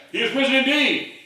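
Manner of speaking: loud, high roar of response; crashing shout. Acted. Vast assembly answering the lone man's greeting, thunderous shout. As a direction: thunder it back like an avalanche. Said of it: "response came crashing like the sound of an avalanche".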